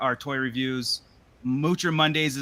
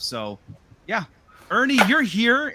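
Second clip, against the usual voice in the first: ordinary talk, nothing marked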